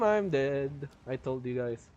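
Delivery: usual speaking voice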